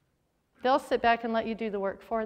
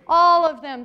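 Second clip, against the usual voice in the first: 1 emphatic answer; 3 semitones higher, 9 dB louder than usual. Real, not acted. Answering her own quiz question.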